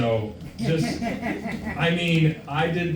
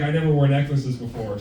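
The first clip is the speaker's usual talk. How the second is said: ordinary talk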